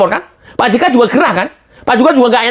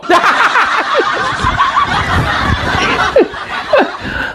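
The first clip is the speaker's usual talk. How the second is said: high drawn-out laughter; long laugh; 3 dB quieter than usual. Real, not acted. Host ridiculing JK's claim, long high laugh. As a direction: laugh at the absurdity.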